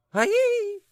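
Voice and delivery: piercing high shriek. Acted. Lady shrieking in fright, very high. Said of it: "shrieked the lady".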